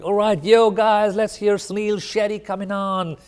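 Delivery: loud, high, hyped-up VJ in a put-on North American accent; mock Canadian-American accent. VJ hyping up viewers, mock Canadian-American accent. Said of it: "That's some Canadian-American accent".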